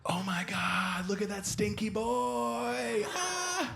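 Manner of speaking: high, slow gushing whisper into a little squeal; high, slow mock girlfriend cooing at a dog; imitating girlfriend's dog squeal. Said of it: "polite, quiet scream that girls do".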